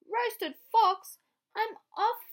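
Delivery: shrieking scared fox; panicked fox. Acted. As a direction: fox bolting in fright.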